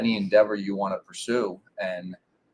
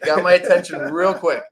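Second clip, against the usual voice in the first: laughing; 8 semitones higher, 6 dB louder than usual. Real, not acted. Laughs recalling dad's close pitch.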